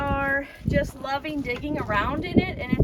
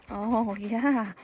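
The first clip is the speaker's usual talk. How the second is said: ordinary talk, nothing marked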